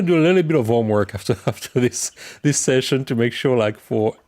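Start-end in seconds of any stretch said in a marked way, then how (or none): none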